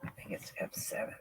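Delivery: breathless unvoiced muttering; whispered to herself. Real, not acted. Sorting out the stream settings alone, whispering to herself. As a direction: whisper to herself while fiddling with the screen.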